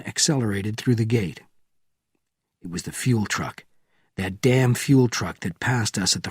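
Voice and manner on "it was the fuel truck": ordinary delivery, nothing marked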